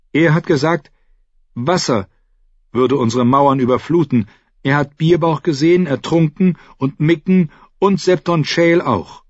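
put-on a young boy retelling a frightening dream; young boy Bran. A narrator's reading. Bran tells Sir Roderick his dream.

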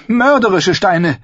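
high, loud accusation; accusing. Acted. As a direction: throw the lie back at him. Sansa retorts. (hoch und laut anklagend)

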